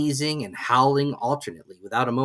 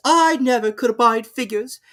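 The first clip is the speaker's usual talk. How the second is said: grand and dismissive; Duchess; 12 semitones higher, 8 dB louder than usual. A narrator's reading. Duchess figure voice.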